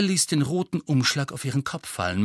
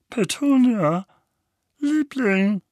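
ängstlich; 11 semitones higher, 4 dB louder, slower than usual. Acted. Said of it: "sagte Onkel Vernon ängstlich".

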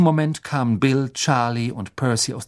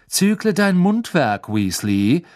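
higher, mocking schoolboy drawl; sneering rich boy; 6 semitones higher, 4 dB louder, slower than usual. A narrator's reading. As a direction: look down on them and enjoy it. Malfoy sneering.

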